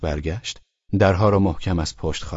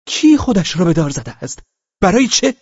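put-on higher, louder, bewildered young voice; tipsy young man; 10 semitones higher, 5 dB louder than usual. Drunk young man answering Velchaninov, bewildered and high. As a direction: toss back the question, baffled.

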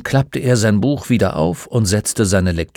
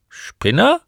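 high rising question; puzzled echo; 14 semitones higher than usual. Acted. Old man questions the boy's nickname, puzzled and high-pitched. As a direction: echo it as a surprised query.

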